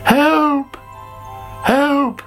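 high, drawn-out cry for help; calling for help. A narrator's reading. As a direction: call out high in alarm. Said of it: "called Sammy".

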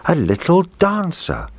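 put-on high, delighted little girl; happy cry. A narrator's reading. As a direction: squeal it out happily. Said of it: "cried out happily".

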